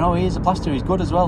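high, put-on voice vouching; mate backing him up. Mate vouching for the plasterer, high eager voice. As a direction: imitate a pal talking up his friend. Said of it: "his mate is backing him up".

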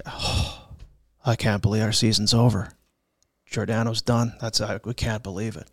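low, deflated locker-room murmur; understated retold despondency; mock dejection. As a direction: imitate a stunned, gutted teammate with a sigh. Teammates slumped after injury, retold.

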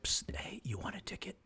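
sneaky whispered sales pitch; stage whisper. Pretend. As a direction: whisper it like a shady ticket tout. Shady ticket seller offer.

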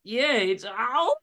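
high hammed-up yelps in Aussie; Aussie patient yelping while plunged; pained cries.

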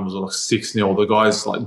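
ordinary talk

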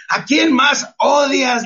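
high, slow, shouted challenge to the crowd; slow shouted question about hate; impassioned shout. Real, not acted. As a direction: call it out like a preacher. Preacher urging audience to forgive.

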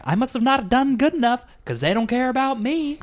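hammed-up wounded martyr fan; mock self-blame. Son blaming himself for losses, theatrically hurt. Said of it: "I'm like".

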